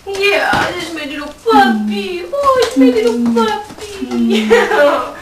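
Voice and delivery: put-on high, loud baby talk, drawn out slowly; cooing at a puppy. Woman cooing over her man-puppy, high and slow. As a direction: gush slowly over your little puppy.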